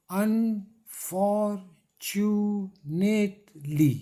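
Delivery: "unfortunately" very slowly in five beats, un-for-tu-nate-ly; teacher chanting out "unfortunately", one puff per syllable. Shown as a demonstration. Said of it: "There are five puffs of air".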